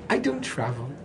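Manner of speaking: put-on pampered young woman protesting lightly; a hint of a spoiled bride refusing to travel; mock prissy bride. Teacher mimics the reluctant bride, lightly spoiled. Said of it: "she says".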